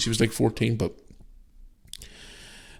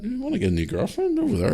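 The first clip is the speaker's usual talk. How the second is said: high teasing kid voice, imitating; son's cheeky tease; 14 semitones higher than usual. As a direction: mimic a cheeky boy egging his dad on. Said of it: "he just laughed and he went".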